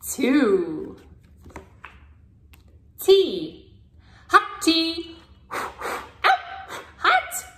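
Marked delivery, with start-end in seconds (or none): 5.5-7.4 a sudden, very high yelp of hot; mock ouch. Playing along with the hot tea page for a toddler, squealed mock ouch. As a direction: pretend you just burned your mouth.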